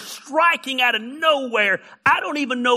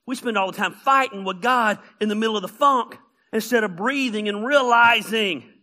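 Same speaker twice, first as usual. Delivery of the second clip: usual speaking voice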